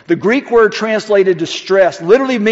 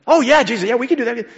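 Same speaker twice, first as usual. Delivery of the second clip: high, eager yes-men voice; lightly voiced eager disciples; 6 semitones higher, faster than usual. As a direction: chirp it like overconfident followers.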